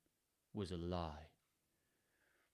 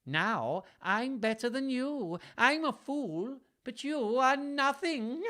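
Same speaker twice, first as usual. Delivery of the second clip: high, slow, gleeful jester voice; high sneering fool; mocking fool; 13 semitones higher, slower than usual. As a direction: crow over the king in a high taunt. Fool mocks Lear.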